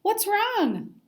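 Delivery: put-on high, caring old lady; concerned grandma. A narrator's reading. Grandma asking upset Simon, high and concerned. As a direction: ask gently as a kind grandma.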